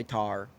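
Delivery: "tar" said like tire, Southern "tar"; "tar" with the i flattened to ah. Retold or imitated. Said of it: "my grandma will say tar".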